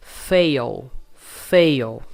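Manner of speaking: FAIL loud and drawn as "fay-ol", voiced to the end; sounds like "feio". Shown as a demonstration. Teacher models it for learners, voiced ending. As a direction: round the L of FAIL almost like feio. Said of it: "Parece que a gente tá falando feio".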